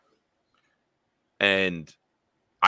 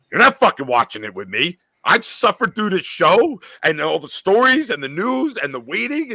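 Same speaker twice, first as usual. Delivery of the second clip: loud, higher, mock furious rant; angry girlfriend; 9 semitones higher, 5 dB louder than usual. Girlfriend scolding him for watching without her, mock furious. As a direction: rant like she suffered alone all season. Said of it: "she was like".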